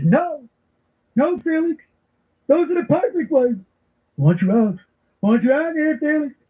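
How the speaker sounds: high-pitched mock exasperation; Walter Matthau fed up. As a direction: act out a movie roommate who's had it. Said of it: "he's had it".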